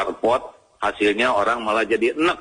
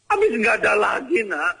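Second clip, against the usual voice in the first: high-pitched mock exasperation; exasperated mother; 11 semitones higher than usual. Mother explaining to children why she married their father, in mock exasperation. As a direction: answer like a fed-up mother.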